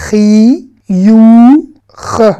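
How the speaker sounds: CHI, YOU, CHE held long and loud, separated; syllable by syllable. Shown as a demonstration. Teacher decomposing the word for smile, syllable by syllable. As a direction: sound out "Chi, you, che" in three stretched pieces. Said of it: "pour vraiment tout décomposer".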